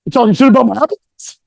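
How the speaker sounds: mock outrage in someone else's voice; offended audience member. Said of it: "I saw some faces like".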